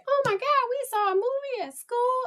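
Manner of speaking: put-on high, fast, squealy child voice; excited kid. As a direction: gush it like a kid home from school. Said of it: "like if they say".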